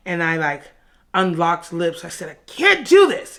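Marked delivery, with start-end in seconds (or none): 2.3-3.4 high, loud panicked protest; acted refusal. Acted refusal after kiss.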